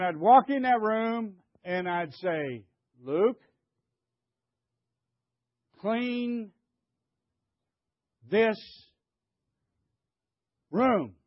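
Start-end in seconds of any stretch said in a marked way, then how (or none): 3.0-11.1 one word at a time; long pauses between each word, as a command; acted stern order. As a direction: spell out an order to a grandson, word by word. Acted order to teenage grandson.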